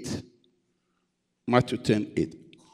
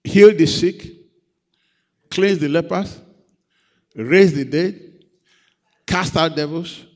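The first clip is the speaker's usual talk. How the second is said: forceful scripture reading; 3 semitones higher, 6 dB louder than usual. Acted. Preacher quotes scripture with force.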